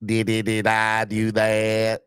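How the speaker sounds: slow, put-on nerdy sitcom voice, stretched out; Urkel impression. Doing the Urkel catchphrase for the live chat. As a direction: play the goofy sitcom nerd owning up.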